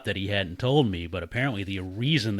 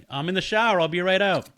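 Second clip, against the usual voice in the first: loud, high call through the door; 9 semitones higher, 5 dB louder than usual. Retold or imitated. Shouting from the shower to his girlfriend, loud call. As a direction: shout it so she hears over the music.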